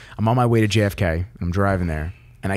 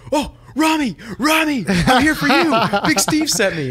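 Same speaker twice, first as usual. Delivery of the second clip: high, excited yelling across the terminal; screaming like a moron; 13 semitones higher than usual. Retold or imitated. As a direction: call out to him like an overeager fan. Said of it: "I start screaming like a moron".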